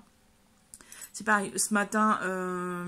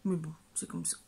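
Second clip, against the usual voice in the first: soft resigned whisper; whispered aside; 3 semitones lower, 7 dB quieter, faster than usual. Real, not acted. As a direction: let it drop into a whisper. Resigned aside about reading slump.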